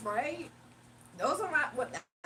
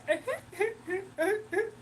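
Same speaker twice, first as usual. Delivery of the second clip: high, squealing mock laugh; crazy cartoon laugh; 8 semitones higher than usual. Streamer imitating Cleveland's crazy laugh, high and squealing. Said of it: "And he be laughing like".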